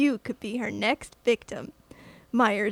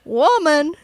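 Scottish "woman" stretched out; imitating the Scottish dad's "woman"; Scottish accent; 4 semitones higher, 9 dB louder than usual. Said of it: "that's what me and my sister used to do".

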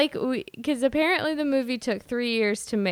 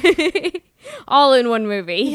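laughing; 3 semitones higher, 10 dB louder than usual. Real, not acted. Laughing at the hair joke.

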